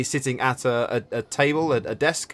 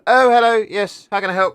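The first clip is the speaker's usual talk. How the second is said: high, loud gnome greeting; cheerful rock gnome; 8 semitones higher, 7 dB louder than usual. Acted. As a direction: pop up brightly to greet the customer. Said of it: "looks up and goes".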